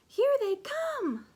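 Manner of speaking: slow, high, loud alarm; hollered. A narrator's reading. Scipito warning his friends, hollering. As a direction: cry out the warning, dragged out. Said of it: "hollered Scipito".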